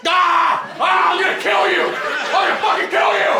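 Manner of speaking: high, loud screaming madman; crazed attacker. Retold or imitated. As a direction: charge in screaming threats. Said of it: "charged me and was like".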